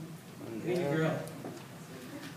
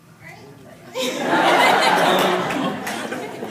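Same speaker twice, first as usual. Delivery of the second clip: high unvoiced squeaky laugh; a silly high-pitched squeal; 12 semitones higher, 9 dB louder than usual. Shown as a demonstration. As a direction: slingshot squeak sound, half laughing. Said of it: "I had the weird button on".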